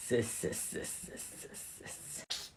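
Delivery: souffler des « c'est » sans voix; chuchotement mystérieux. Acted. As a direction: chuchoter comme une incantation secrète. Élève chuchote une incantation étrange. (élève bizarre en classe, chuchotement étrange)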